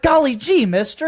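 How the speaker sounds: put-on high, loud golly-gee kid voice; wholesome 1950s kid. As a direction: chirp it like an eager fifties boy. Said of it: "like, generic fifties, sixties".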